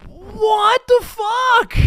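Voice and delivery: very high, loud, slow drawn-out shout; outraged shout. Real, not acted. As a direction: stretch it out in shocked outrage. Outraged reaction to remark.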